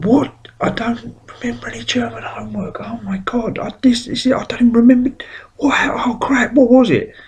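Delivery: high, hushed panicked whisper with a sudden high yelp at the start. Retold or imitated. Schoolboy realising the homework is due, panicked high whisper.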